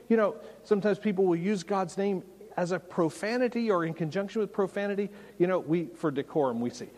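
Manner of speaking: ordinary talk, nothing marked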